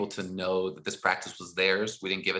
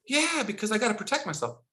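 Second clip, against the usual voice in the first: put-on higher kid voice, a touch defiant; 11 semitones higher than usual. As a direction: answer like a stubborn fifth grader. Boy's answers voiced.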